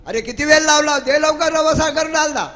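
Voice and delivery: loud, high-pitched scolding; angry mother. Acted. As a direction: scold the dawdling child at full voice. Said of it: "तेव्हा आई रागवली". (रागावलेल्या आईचा चढ्या आवाजातला ओरडा)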